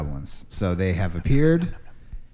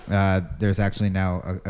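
normal talking voice

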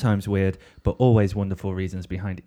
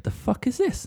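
a hint of an indignant gorilla, much higher and softer; 13 semitones higher, 5 dB quieter than usual. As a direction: squeak it out, baffled at the stranger in his seat. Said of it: "and he's like".

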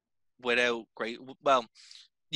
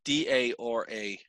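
"D A R A" slowly, letter by letter; spelled out clearly; slower than usual. Shown as a demonstration. Asked by co-host to repeat his name's spelling, slow and clear "D A R A". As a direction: spell "D A R A" slowly for an American listener. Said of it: "Can you say that again with less of an Irish accent".

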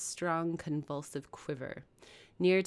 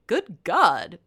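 mock cry; 3 semitones higher, 10 dB louder than usual. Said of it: "cried faintly".

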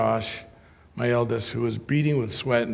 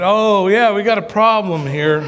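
louder, higher mock-cheery announcement; wry alarm; 6 semitones higher, 7 dB louder than usual. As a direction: sarcastically announce the trouble. Car breaks down, ironic remark.

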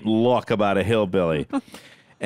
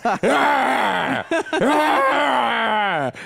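mock pained yells; 13 semitones higher than usual. Ned in the scene, yelping in hammed-up pain. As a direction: ham up the cries of pain. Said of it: "and he's going".

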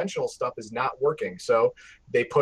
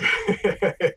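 short laugh; 5 semitones higher than usual. Real, not acted. Reacting to the "Therapy Minute" joke.

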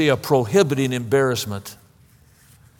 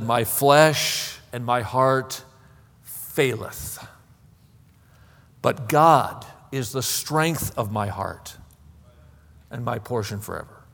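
slow, low recitation with long pauses; solemn scripture reading; 4 semitones lower, 4 dB quieter, slower than usual. Acted. Preacher quotes the psalmist to the congregation, slow and solemn. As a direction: recite it gravely, sinking softer at the end.

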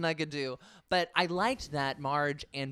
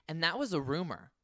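ordinary talk, nothing marked

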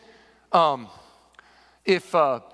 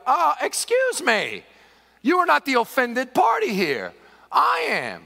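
higher, indignant protest; offended neighbor; 6 semitones higher, 4 dB louder, slower than usual. Acted. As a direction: object loudly as the wronged party. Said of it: "the neighbor would be like".

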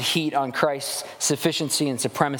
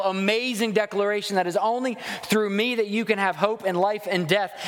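ordinary delivery

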